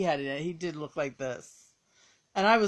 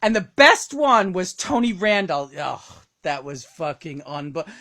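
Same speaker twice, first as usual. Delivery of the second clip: usual speaking voice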